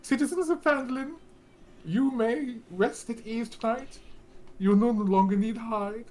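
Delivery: high, booming town-crier address; pompous public speech, pitched high and loud; loud street proclamation. Acted. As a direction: proclaim grandly to the whole town. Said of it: "loudly in the streets, you hear him proclaiming".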